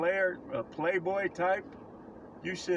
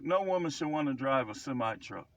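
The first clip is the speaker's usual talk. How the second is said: normal talking voice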